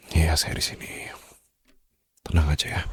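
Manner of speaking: hushed whisper of comfort. Acted. Holding the girl close, whispering that he is here. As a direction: whisper it into her hair to calm her. Comforting her during a hug.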